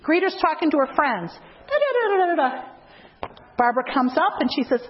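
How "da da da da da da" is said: high sing-song da-da babble; mock chatter. Greeter gabbing to friends, high babbling chatter. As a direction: mimic mindless small talk.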